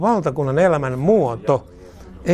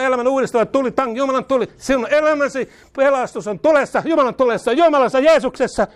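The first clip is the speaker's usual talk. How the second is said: high, driving, raised preaching voice; fiery preaching; 7 semitones higher than usual. Real, not acted. Preacher declaring healing to listeners, fiery high preaching. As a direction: pour fire over the room.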